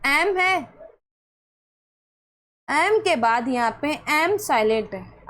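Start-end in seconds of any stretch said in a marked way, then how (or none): none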